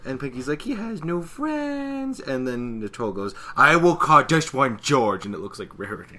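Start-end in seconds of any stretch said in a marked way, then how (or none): 0.6-2.2 high, slow, drawn-out whine; mock sad Pinky. Pinky's acted sad wail. 3.6-5.3 loud, slow, higher troll declaration. Retold or imitated. Voicing the troll.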